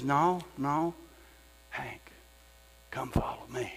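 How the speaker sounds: soft, breathless whispered call; gentle whisper. Acted. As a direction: draw them in with a whisper. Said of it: "he says".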